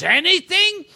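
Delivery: put-on old boss asking. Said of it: "And he goes".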